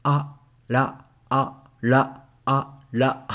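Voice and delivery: la with a light flapped r after a; r sound drill. Shown as a demonstration. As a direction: contrast a and la for learners. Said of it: "the sound is like a".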